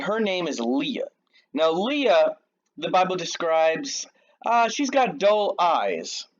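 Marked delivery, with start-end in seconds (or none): none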